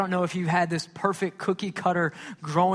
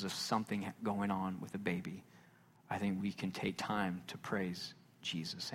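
soft reverent preaching; 6 semitones lower, 10 dB quieter than usual. Acted. Pastor quietly urging the congregation to praise Jesus, reverent hush. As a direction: let it sink in quietly.

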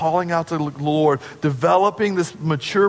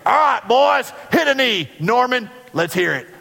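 barking coach; 8 semitones higher than usual. Retold or imitated. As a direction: call the boys to kneel like a coach. Coach calling players to kneel.

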